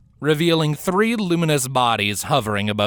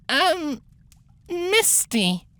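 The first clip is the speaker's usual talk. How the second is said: hesitant Misty voice; much slower than usual. Acted.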